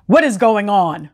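ordinary delivery, nothing marked

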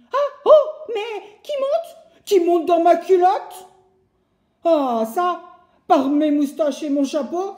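high, loud panicked shouting; woodcutter hollering. Acted. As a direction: squeal as something climbs his trousers. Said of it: "le bûcheron hurle et crie". (cris paniqués, aigus et forts)

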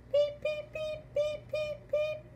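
high squeaky chick peeps; peeping baby chick. Shown as a demonstration. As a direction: peep like a tiny hungry chick. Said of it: "or peep like a, like a chick".

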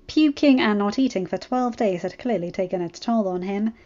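ordinary delivery

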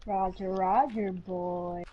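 low, soft, trailing catchphrase; subdued, low-pitched radio acknowledgement; droid catchphrase. Pretend. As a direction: drop it deep and quiet. Repeating droid catchphrase in play.

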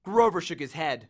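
usual speaking voice

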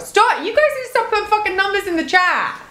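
high, fast exasperated outburst; sharp high cry into a quick scolding; exasperated order. Real, not acted. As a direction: tell them off, high and rushed. Ordering chat to stop spamming.

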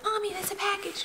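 put-on high, soft little-kid voice; light, high-pitched child impression; imitating young daughter. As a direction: mimic a small child calling to mom. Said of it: "she's always like".

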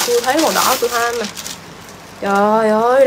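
usual speaking voice

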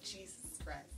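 breathless whisper under the breath; whispered dread. Retold or imitated. Child whispering in dread after being sent for the belt. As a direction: mutter it in horror.

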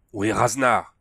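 loud demanding call; shouting. A narrator's reading. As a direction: shout the question across the cathedral. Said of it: "cria-t-il".

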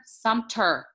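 Sumter as a firm SUM-ter, no N; self-corrected pronunciation. Shown as a demonstration. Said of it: "It's not Sumter".

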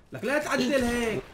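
high, slow, fed-up complaint; exasperated scolding. Real, not acted. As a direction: tell him off in disbelief. Scolding teammate's building. (توبيخ متضايق)

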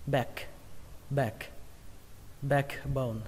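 "back" with a flat A close to E, like "bek"; "back" and "backbone" with the vowel between A and E. Shown as a demonstration. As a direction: say "backbone" as "bek-bone", the parts kept apart. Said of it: "nghe giống như âm E".

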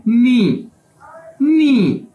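careful demo of नी, rising up; Chinese word pronounced. Shown as a demonstration. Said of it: "इसकी pronunciation". (नी को साफ़ बोलें, दूसरी बार ऊँचे सुर में)

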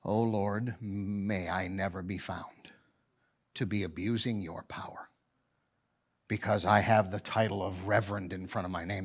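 hushed, pleading prayer to the Lord, one phrase breathed almost silent; low, hushed prayer for help; quiet prayer. Retold or imitated. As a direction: pray softly and humbly, a low plea. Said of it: "that causes me just to go".

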